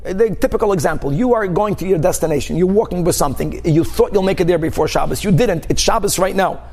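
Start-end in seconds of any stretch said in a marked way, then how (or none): none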